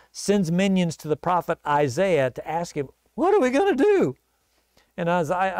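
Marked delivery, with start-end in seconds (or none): 3.1-4.2 high, alarmed cry for help; panicked question. Acted. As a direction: sound desperate and lost. Panicked king asks the prophet.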